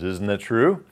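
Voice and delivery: questioning lift on TRUE; rising question tune. Shown as a demonstration. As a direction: voice climbs on TRUE. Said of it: "Our voice is going up".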